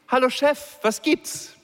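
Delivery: a policewoman cheerfully greeting her furious boss; breezy and friendly. A narrator's reading. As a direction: play it carefree, as if nothing happened. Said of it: "rief so unbefangen und freundlich wie nur möglich".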